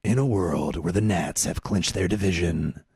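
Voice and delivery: low, weighty trailer narrator; movie trailer voice. Pretend. Host hyping the Nats in a mock movie trailer voice. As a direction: do the deep movie-guy voice. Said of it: "That's the movie voice".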